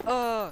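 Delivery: loud, high pretend yelp; sudden play-acted cry of "oh"; mock pain cry. Figure just shot, mock pain cry.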